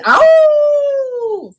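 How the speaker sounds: high, very slow drawn-out ow; howling animal. Acted. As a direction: howl it like a hyena. Imitating a hyena howl.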